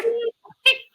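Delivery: high, bursting laugh of joy; delighted laugh. Real, not acted. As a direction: laugh out loud, thrilled. Delighted at fist pump question.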